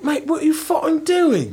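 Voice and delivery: high-pitched mock British cop; incredulous English bobby impression. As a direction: exclaim it in a put-on British accent. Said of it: "the cop's like".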